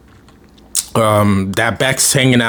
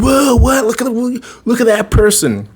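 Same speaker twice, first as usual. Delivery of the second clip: high astonished whoa; amazed gawking; 10 semitones higher than usual. Retold or imitated. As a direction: gape at the cool car. Students gawk at cool car.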